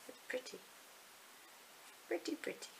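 soft whisper rising into a high coo; whisper, then coo it up high. Real, not acted. Whispering then cooing over pretty pink yarn.

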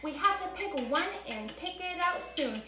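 put-on bouncy storybook rhythm for kids; lively read-aloud for children. A narrator's reading. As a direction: read it like story time for little ones. Reads Seuss story to kids.

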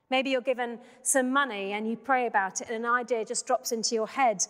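ordinary talk, nothing marked

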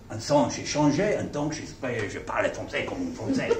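speaking French with a put-on French accent, half laughing. Shown as a demonstration. Preacher demonstrating his French accent trick to the congregation, playful French accent. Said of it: "speak English in a very strong French accent".

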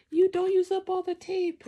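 high, loud mock scolding mom. Recalling mom about the label maker tape, high loud mock scold. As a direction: squeal it like a nagging mother. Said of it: "your mom was like".

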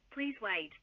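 normal talking voice

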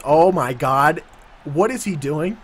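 usual speaking voice

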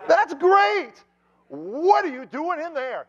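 high, loud amazed outburst; astonished shout. Retold or imitated. Driver amazed by inmate's solution.

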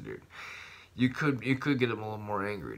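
ordinary talk, nothing marked

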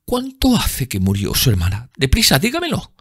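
high, hurried urgency; urgent plea. A narrator's reading. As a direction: press him for the answer quickly. Dorian urgent question.

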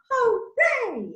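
hooray leaps high; cheering hooray. Shown as a demonstration. Song shout hooray.

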